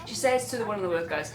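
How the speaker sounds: usual speaking voice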